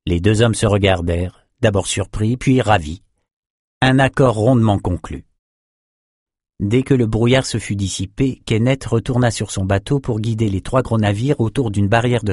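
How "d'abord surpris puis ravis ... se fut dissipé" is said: ordinary delivery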